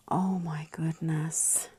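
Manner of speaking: slow awed whisper. Real, not acted. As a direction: breathe it in wonder. Awed over stamp.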